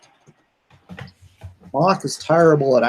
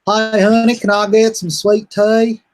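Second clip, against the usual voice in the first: put-on nasal Southern accent; 8 semitones higher, 5 dB louder than usual. To S0, mocking North Carolina sweet tea talk in a nasal accent. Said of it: "talk like this, real nasal".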